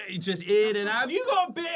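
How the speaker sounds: high, loud rowdy voice; punter voice. Retold or imitated. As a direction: play the clueless festival-goer. Mimicking drop-in punter.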